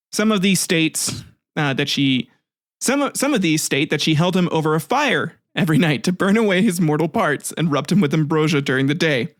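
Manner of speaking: usual speaking voice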